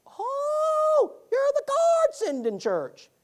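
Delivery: put-on high, slow, drawn-out old woman's voice; old lady voice. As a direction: imitate a delighted elderly lady, stretching the words. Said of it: "That's how she said it".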